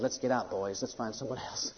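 low, soft conspirator's voice; retreating fever. Acted. Fever telling its boys to flee, low hushed urging. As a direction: quietly urge the gang to slip away. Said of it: "And the fever looks around. He says".

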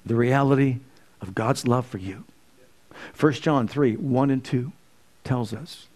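ordinary talk, nothing marked

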